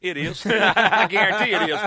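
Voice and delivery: laughing while answering. Real, not acted. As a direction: chuckle through the words. Host laughs confirming caller's guess.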